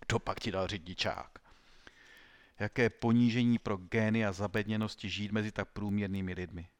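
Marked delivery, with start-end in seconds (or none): none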